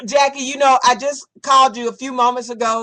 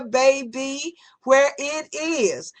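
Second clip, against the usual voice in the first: ordinary delivery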